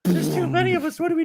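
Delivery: put-on frightened youngling in a Scottish accent; mock scared Scottish child. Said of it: "In his little Scottish accent".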